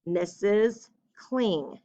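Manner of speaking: slow, drawn-out dictation; dictating slowly. Shown as a demonstration. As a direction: spell it out as you write. Teacher dictates letter aloud.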